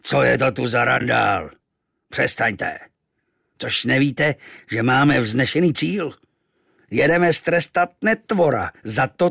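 angry captain ordering the crew to bed; roaring captain Ahab. A narrator's reading. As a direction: thunder at the crew. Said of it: "zařval".

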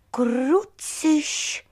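high-pitched mild oath; mild exclamation. Acted. Sun realising its blunder with the flower, high mild exclamation. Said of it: "povídá sluníčko taky málem po latinsku".

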